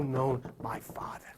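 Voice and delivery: hushed, voiceless whisper. Acted. Preacher whispering the words of Jesus to the congregation. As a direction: drop to a breathy whisper on the quote.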